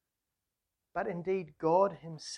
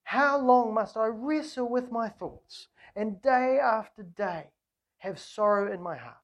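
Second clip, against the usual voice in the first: anguished lament; 4 semitones higher, 6 dB louder than usual. Acted. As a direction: draw out each word like a long-suffering prayer. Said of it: "he's in deep anguish".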